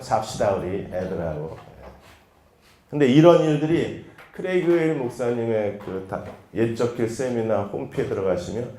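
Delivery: normal talking voice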